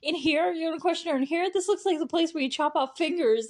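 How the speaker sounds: high, loud imitation of a freaked-out mom; freaking out. Mom protesting to cops about questioning her daughter, imitated freaking out. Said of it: "you're freaking out".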